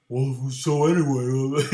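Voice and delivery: slow, dopey teen voice, lightly put on; doofus kid impression. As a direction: drag it out like a goofy kid. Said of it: "they sound like doofuses when I imitate them".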